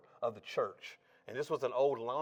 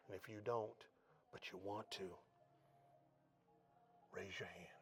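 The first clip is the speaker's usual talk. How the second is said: gentle altar call, trailing off into a whisper; hushed preacher's invitation; fading to a whisper; 5 semitones lower, 18 dB quieter than usual. Retold or imitated. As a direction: draw them in, softer and softer.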